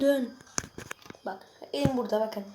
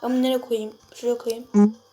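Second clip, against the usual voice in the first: normal talking voice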